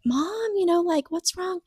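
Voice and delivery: put-on high, light little-girl voice; children in the doorway asking mom what's wrong in high voices; worried child. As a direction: voice the daughters, small and worried. Children asking their panicking mother.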